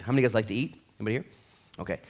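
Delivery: usual speaking voice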